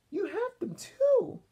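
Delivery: put-on high startled old-lady voice; Grandma Thora voice. Grandma reacting to D W's spots, high and startled. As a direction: gasp it out like a fussy grandma. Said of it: "said Grandma Thora".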